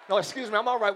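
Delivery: ordinary talk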